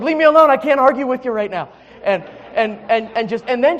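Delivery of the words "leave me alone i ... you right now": high, loud, hurried protest; exasperated plea from a worn-out husband; mock exasperation. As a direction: play up being fed up, quick and loud. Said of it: "I'm just like".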